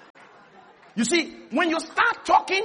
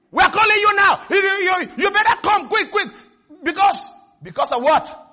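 loud, high, demanding caller; bully the debtor down the phone; harsh debt collector; 7 semitones higher, 5 dB louder than usual. Pretend. Debt collector yelling at a debtor to pay now.

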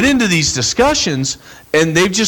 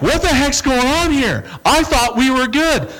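confused friend; 10 semitones higher than usual. Retold or imitated. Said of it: "they're like".

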